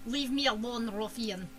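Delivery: deep, low Russian man; Russian accent. Acted. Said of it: "I'm still doing Russian for all of them".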